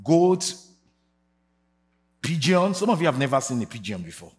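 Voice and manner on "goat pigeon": preaching. Real, not acted. Sung preaching cadence.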